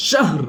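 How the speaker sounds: শাহর with h blended into a final r; joined h and r. Shown as a demonstration. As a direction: run the h straight into r in শাহর. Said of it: "তাহলে কিভাবে পড়বো". (হা আর র মিলিয়ে শাহর)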